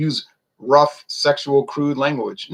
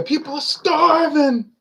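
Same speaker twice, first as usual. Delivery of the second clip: much higher, slow mock alarm; mock hysteria; 8 semitones higher, slower than usual. Mocking old-time hysteria to Ron, high slow parody voice. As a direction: ham up the panicked plea for money. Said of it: "A lot of hysteria".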